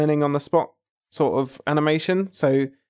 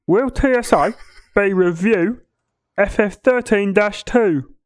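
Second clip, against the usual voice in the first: put-on higher, slow French accent reread; 7 semitones higher, 3 dB louder, slower than usual. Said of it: "ask the question again".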